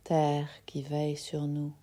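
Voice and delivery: ordinary talk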